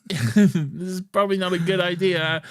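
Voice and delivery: mock worry. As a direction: say it with mock unease.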